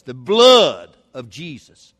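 "blood" very loud and long drawn out; slow, exaggerated mock distaste; mocking disdain. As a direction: imitate someone repelled, dragging out "blood". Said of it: "and he made a face".

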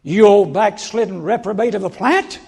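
hammed-up fury, shouting bloom, bloom; mock angry scolding. Preacher scolding the plant like a backslider, mock furious shout. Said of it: "I'd get mad at it".

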